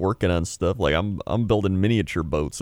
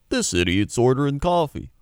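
higher-pitched mock online scolding; mocking commenters; 7 semitones higher than usual. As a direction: imitate a scornful commenter, pitched up.

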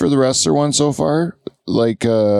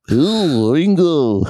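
put-on higher, swooping Liverpool accent; 5 semitones higher, much slower than usual.

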